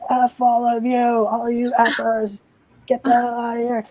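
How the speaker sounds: high, strained imitation of a raging guest; mock angry yell. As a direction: yell it high, half-hearted. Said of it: "and he's like".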